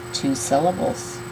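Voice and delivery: ordinary talk, nothing marked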